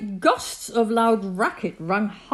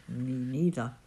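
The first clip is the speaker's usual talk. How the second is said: low, soft little echo; subdued low agreement; kangaroo in pouch; 9 semitones lower, 7 dB quieter than usual. A narrator's reading. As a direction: chime in quietly, pitch dropped. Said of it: "the kangaroo in her pouch said".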